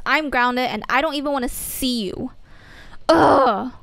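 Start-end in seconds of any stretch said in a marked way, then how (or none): 3.1-3.7 loud fed-up ugh; furious friend walking away; angry groan. Acted. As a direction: blow out your anger in one loud grunt. Storming off angry.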